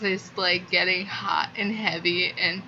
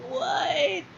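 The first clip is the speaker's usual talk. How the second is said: a shrill squeak of disbelief, much higher; shocked what. Retold or imitated. Fan recalling learning two characters are related, squeaking in shock. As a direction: gasp it out in total disbelief. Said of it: "I was like".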